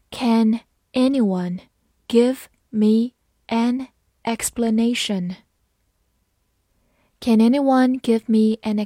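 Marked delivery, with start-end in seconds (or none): 0.1-5.4 word by word, with pauses between words; slow reading. Shown as a demonstration. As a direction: read it out slowly for learners. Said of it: "好，慢慢来读". (慢慢逐词朗读)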